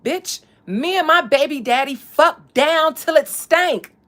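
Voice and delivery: loud, slow and emphatic. Retold or imitated. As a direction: drag it out with full conviction. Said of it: "she said it so convincingly".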